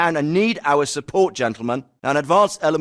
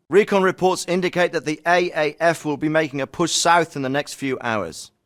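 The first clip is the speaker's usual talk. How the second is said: ordinary delivery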